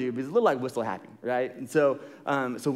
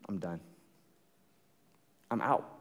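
dejected; 7 semitones lower, 7 dB quieter than usual. Retold or imitated.

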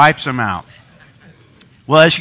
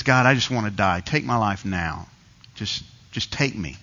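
low, softly despondent plea; retold self-pity; 4 semitones lower, 5 dB quieter than usual. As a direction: give up wearily, sinking softer. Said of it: "he's wallowing in self-pity".

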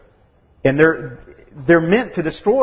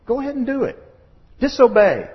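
much higher coaxing tempter; tempting Satan; 10 semitones higher than usual. Retold or imitated. Satan urging Adam and Eve to disobey, high and coaxing. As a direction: coax them into sin. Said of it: "and Satan says".

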